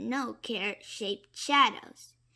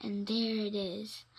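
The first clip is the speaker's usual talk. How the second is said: ordinary delivery, nothing marked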